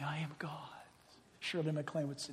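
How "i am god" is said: an unvoiced whisper, leading the group to repeat. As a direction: murmur it back as a chant. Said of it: "Say it again with me".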